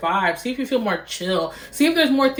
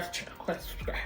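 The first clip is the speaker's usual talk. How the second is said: whispering; 16 dB quieter, much faster than usual. Real, not acted. Whispered repeat.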